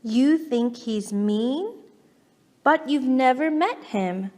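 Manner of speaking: plain reading voice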